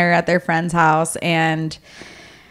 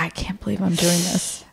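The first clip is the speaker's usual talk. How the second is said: muttered disbelief; 9 dB quieter than usual. Retold or imitated. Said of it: "he's grumbling to himself".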